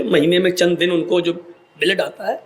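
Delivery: usual speaking voice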